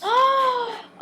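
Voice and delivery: high sharp gasp; shocked gasp. Real, not acted. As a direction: gasp in mock scandal. Shocked at boobs joke.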